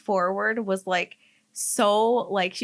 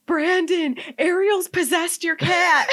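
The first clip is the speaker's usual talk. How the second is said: high, loud, half-laughing alarm; panicked shout; 10 semitones higher, 7 dB louder than usual. Retold or imitated. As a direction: yell it like the cat is truly possessed. Retelling her panicked cry to Brandon.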